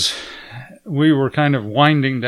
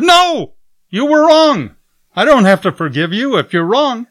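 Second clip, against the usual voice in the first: loud, much higher flat refusal; refusing angrily; 10 semitones higher, 7 dB louder than usual. Retold or imitated. Host refusing to forgive the American, loud and angry. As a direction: slam the door on forgiveness.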